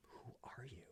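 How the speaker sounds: unvoiced whisper, barely there; whispered inner thought. To the stranger who is her mom, a stunned whisper. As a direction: breathe the question out without voice.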